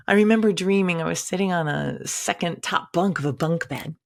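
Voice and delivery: normal talking voice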